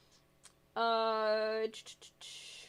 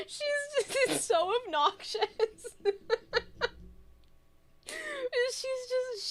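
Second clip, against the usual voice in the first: laughing. Real, not acted. Laughing about obnoxious aunt.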